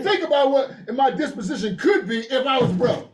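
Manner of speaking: high-pitched, worked-up protest; agitated insistence. Real, not acted. Speaker recoiling at being broke, agitated insistence. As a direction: push the voice up in exasperation.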